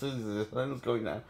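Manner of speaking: calm Bruce down softly, as to a spooked animal; soothing hush. Retold or imitated. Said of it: "that works on Bruce Banner when he says".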